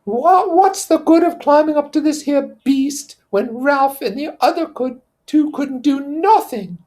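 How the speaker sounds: put-on high, loud derisive boy; Piggy's voice. Piggy mocking Simon's plan to climb. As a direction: scoff at a pointless idea.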